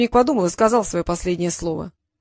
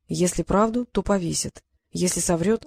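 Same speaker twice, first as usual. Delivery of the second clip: ordinary delivery, nothing marked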